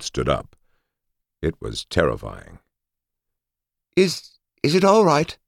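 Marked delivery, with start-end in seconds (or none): 3.9-5.4 high, nervous stammer; stuttering. A narrator's reading. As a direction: stutter it out, scared. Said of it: "Holly stuttered".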